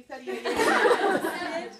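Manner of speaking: slow, high laughing guess; laughing question. Real, not acted. Speaker to sister, guessing her age while laughing. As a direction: stretch it out through a laugh.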